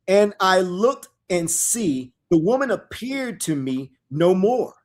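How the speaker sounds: slow, loud, solemn reading of scripture; dramatic scripture reading. Acted. Preacher reading prophecy aloud to his listeners, solemn and loud. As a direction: proclaim the verses slowly to the congregation.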